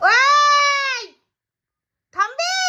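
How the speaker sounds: loud, high call to make the pet stop; sharp scolding call. Real, not acted. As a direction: snap a loud warning at the pet.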